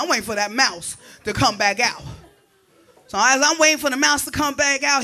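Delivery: usual speaking voice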